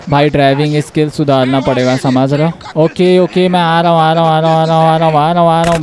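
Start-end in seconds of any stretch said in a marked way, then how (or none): 3.5-5.8 rattled off on one level note; hurried repetition. Real, not acted. Player telling his crew he is coming, hurried monotone. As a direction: repeat it in an unbroken monotone stream. Hurrying after car in chase.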